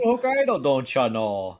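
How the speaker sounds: "Hokkaido" pitched high, then a folksy "don't ya know"; Minnesota accent. Retold or imitated. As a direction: tease in a mock Minnesota accent. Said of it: "everyone do Minnesota accents".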